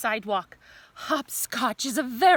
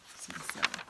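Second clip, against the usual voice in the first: whispered; 7 dB quieter, faster than usual. Shown as a demonstration. Whispered repeat of the same words.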